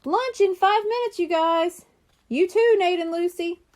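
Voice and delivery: high call across the yard; yelling. A narrator's reading. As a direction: call the kids in from the house. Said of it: "Josh yelled from the house".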